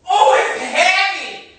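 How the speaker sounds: high, slow alarmed wail; scared. Real, not acted. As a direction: cry out high at the unexpected weight. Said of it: "I'm scared".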